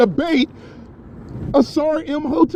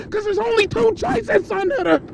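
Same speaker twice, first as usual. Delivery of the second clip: ordinary talk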